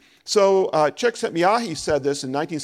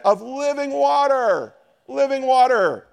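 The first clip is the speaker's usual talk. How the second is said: slow, high and loud, driving the phrase home; preaching climax; 8 semitones higher, 5 dB louder, slower than usual. Acted. Preacher proclaiming living water to the congregation, loud and high. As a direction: lift the whole room on these words.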